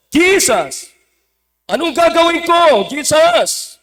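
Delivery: high, loud acted outcry; preacher shouting the devil's words, pitched high; devil's cry. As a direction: cry out loudly as the cornered devil. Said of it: "Anong sigaw ng diablo?".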